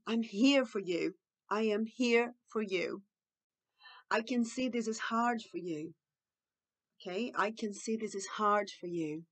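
slow, calm comforting; tender reassurance. Shown as a demonstration. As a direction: reassure a child slowly and sincerely. Models empathetic phrases. (consolo calmo e lento)

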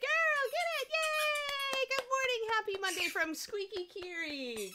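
very high, squeaky praise for the dog; excited dog-praise voice. Real, not acted. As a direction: cheer the puppy on in a high sing-song. Talking to her excited dog.

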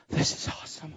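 very soft, nearly whispered; hushed awe. Retold or imitated. Healed lady answering how she's doing, hushed awe. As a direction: imitate someone quietly overcome.

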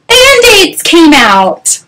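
high, slow drawn-out surprise; storyteller's hammed-up amazement; astonished reveal. A narrator's reading. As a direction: stretch it out high in mock shock.

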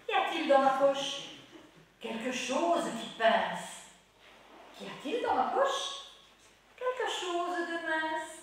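loud playful rhyme, slow answers; reciting a nursery rhyme. Acted. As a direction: tease the children with each line.

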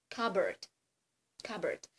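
English cupboard, spoken slowly; English word. Shown as a demonstration. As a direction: say cupboard as "kabert", p silent. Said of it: "Ось, наприклад, є слово".

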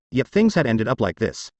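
ordinary delivery

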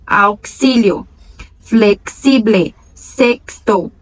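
flexible said slowly with a ks sound; slow out each x as k plus s. Shown as a demonstration. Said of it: "como se tivesse um Q ali sutil". (x pronunciado como ks, devagar)

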